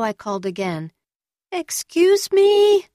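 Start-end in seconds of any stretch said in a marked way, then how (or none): 1.5-2.9 very high, loud and drawn out; louder call. A narrator's reading. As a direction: call out much louder, slowly.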